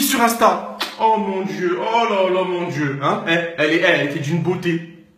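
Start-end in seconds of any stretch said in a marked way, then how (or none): none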